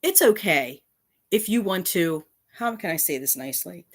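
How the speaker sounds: usual speaking voice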